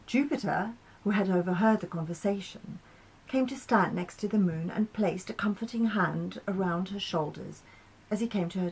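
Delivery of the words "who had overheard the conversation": ordinary delivery, nothing marked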